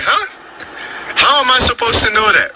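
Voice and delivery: can't believe what he just heard; shocked disbelief. Retold or imitated. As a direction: voice jumping up in stunned surprise. Shocked by mom's answer.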